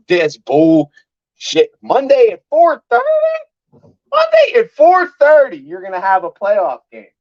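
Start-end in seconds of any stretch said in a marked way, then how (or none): 1.8-5.6 incredulous cry rising into a high, loud shout; stretched, disbelieving outcry at the schedule; outraged cry. Real, not acted. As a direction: yell the time like you can't believe it. Outraged at late game time.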